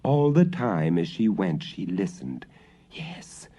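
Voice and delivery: usual speaking voice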